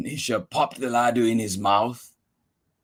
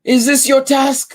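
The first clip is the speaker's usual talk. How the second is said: put-on old man; 12 semitones higher, 6 dB louder than usual. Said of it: "the old man asked".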